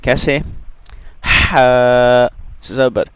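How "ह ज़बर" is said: ह drawn out slowly and loud from the middle of the throat; teacher spelling ह for students; slow throat letter drill. Shown as a demonstration. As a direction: stretch ह ज़बर slowly and loud from mid-throat. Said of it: "हलक के दरमियान वाले हिस्से से".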